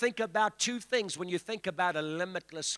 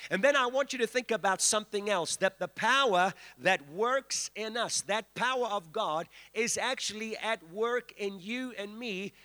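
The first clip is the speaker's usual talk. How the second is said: usual speaking voice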